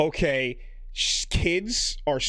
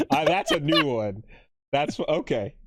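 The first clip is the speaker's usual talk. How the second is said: usual speaking voice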